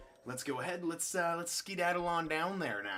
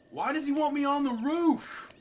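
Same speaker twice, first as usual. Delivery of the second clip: high, loud wailed question; loud, high-pitched puzzlement; exasperated bafflement; 13 semitones higher, 9 dB louder than usual. Real, not acted. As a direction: wail it in disbelief. Baffled by being summoned up high.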